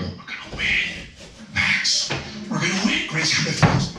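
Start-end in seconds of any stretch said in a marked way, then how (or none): 0.0-2.1 barely audible, voiceless whisper; hushed, breath-only delivery; whispered promise. Retold or imitated. Retold intimate promise to son. 2.5-3.1 rising lift on "win"; out of the whisper into a rising voice; voiced promise. Repeats the promise aloud.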